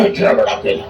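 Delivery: low, soft comic deflation; mock tiny voice. Englishman shrinks voice for small banana.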